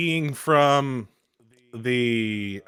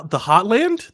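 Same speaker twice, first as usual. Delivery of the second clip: higher, rising puzzled question; mock confusion; 7 semitones higher, 4 dB louder, slower than usual. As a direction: act as if you misheard the word. Mock mishearing of heartland.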